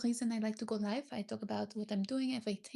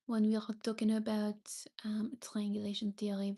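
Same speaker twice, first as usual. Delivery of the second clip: normal talking voice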